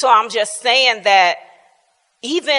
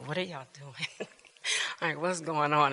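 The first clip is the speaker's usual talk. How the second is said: hushed concern; 6 semitones lower, 11 dB quieter than usual. Retold or imitated. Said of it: "she was like".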